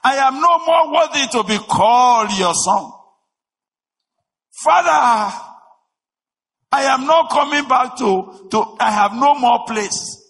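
high, loud, anguished cry to his father; prodigal son pleading. Acted. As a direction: plead for forgiveness with a shout. Voicing the prodigal son's confession.

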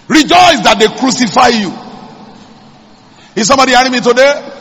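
fervent preaching. Acted. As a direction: fire up the congregation. Preaching at full force.

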